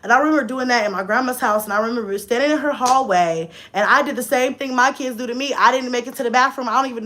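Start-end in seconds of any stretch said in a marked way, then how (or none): none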